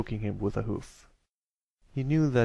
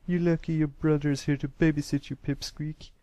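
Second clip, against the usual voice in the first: higher-pitched bully's parting jeer; scornful; 5 semitones higher than usual. A narrator's reading. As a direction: scorn him while making off. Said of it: "the grey colt scorned as he ran off".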